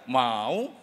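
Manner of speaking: "mau" drawn out, dipping then rising; doubtful intonation. Shown as a demonstration. Answering an offer of clothes, doubtful drawn-out rise on "mau". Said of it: "Intonasi meragukan".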